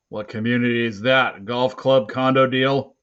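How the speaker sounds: loud, doubting officer voice; sceptical officer. A narrator's reading. Officer quizzing a boy about his community, loud and sceptical. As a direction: question it loudly, not buying the story.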